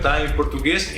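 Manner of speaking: normal talking voice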